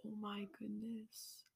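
hushed whisper, re-enacted; barely audible, imitating a choked-up reader; tearful awe. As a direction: whisper it as if overcome while reading. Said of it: "I cried while reading it".

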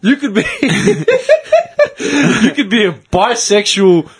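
high-pitched, helpless giggling delivery; laughing through words. Real, not acted. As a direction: fight the laughter while finishing the joke. Joking about absurd faith combination.

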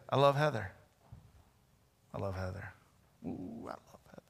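saying he loves his wife, trailing to a hush; fading to whisper. Shown as a demonstration. Pastor repeating love for wife.

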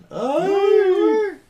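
high loud ay of delight; delighted squeal. Real, not acted. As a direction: squeal at the cute puppy. Reacts to the puppy. (chillido agudo de ternura)

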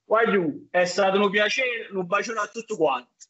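light Neapolitan greeting; Neapolitan accent. Shown as a demonstration. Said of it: "ti dico una frase che devi dire".

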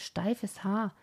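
ordinary delivery, nothing marked

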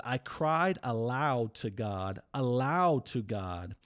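slow, drawn-out cry to God; emotional scripture reading. Retold or imitated. Reading the psalmist's cry to listeners, slow and pleading. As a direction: lean into each word, slowly pleading. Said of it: "listen to the honesty and the emotion behind it".

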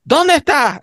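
put-on high, far-off calling voice of an old woman; imitating a grandmother shouting for her husband; calling out grandma.

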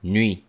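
teacher stresses nuit. Shown as a demonstration. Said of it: "we don't pronounce the letter T".

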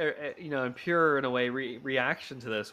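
ordinary delivery

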